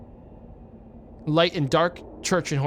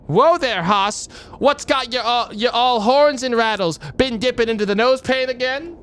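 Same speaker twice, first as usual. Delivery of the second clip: cowboy voice; 7 semitones higher, 5 dB louder than usual. Acted. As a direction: yell it out like a rowdy prospector. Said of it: "Gabby doesn't talk like that".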